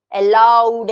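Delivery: loud, stressed demo of allowed; teacher shows how allowed is said with force; said aloud. Shown as a demonstration. Teacher demonstrating stress to students, loud. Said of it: "कुछ शब्दों को जोर से बोला जाता है".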